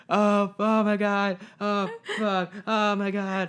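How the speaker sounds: high, slow, horrified gasping; panicked coworker. Retold or imitated. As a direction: play the coworker frozen in horror in the corner. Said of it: "He's just like".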